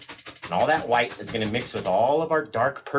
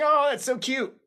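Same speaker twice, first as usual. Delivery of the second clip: cooing; 12 semitones higher than usual. Real, not acted. Seeing video of bouncing kid.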